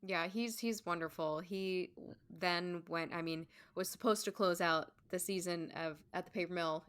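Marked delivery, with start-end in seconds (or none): none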